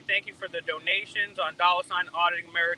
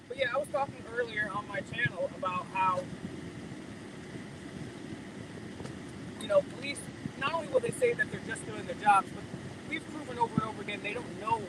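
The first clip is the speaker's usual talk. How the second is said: muffled, mic covered by a finger; talking through a covered mic; finger over the mic; 9 dB quieter than usual. Real, not acted. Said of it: "I believe you're covering your, your mic".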